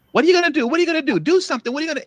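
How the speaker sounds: very high mock taunting voice. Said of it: "and if he does something".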